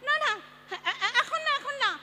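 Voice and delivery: high and loud, close to tears; frantic plea into the phone, almost crying; panicked plea. Acted. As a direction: beg the caller to hold off, voice cracking. Begging caller not to come now.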